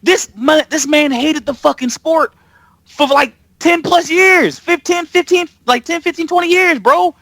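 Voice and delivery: high-pitched, exasperated disbelief; incredulous rant. Real, not acted. Host protesting the coach hated the sport, incredulous rant. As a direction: argue it in a high voice, stretching the years.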